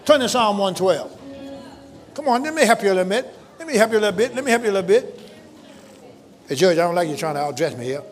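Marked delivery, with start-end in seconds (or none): 0.0-5.2 high, loud, ringing preacher's call; preaching at full force. Real, not acted. Pastor rousing the congregation toward Psalm one twelve. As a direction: lift the church with every line.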